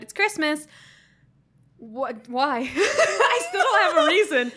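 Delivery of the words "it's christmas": higher-pitched mock shout; retold shout. Sick student to roommates, mildly shouted retelling. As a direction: playfully re-enact a festive outburst. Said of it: "just shouted".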